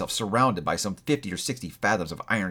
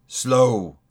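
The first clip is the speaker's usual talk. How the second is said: louder, weighty single word; ghostly echo; 6 dB louder than usual. A narrator's reading. The ghost echoes Scrooge's word, weighty and grim. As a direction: throw the word back heavily.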